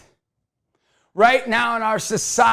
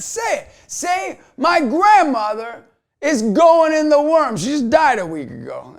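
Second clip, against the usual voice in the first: daring challenge; 8 semitones higher, 5 dB louder than usual. Retold or imitated. He dares the atheist.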